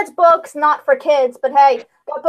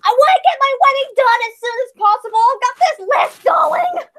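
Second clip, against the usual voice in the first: cranky bride; 9 semitones higher than usual. Retold or imitated. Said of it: "she's like".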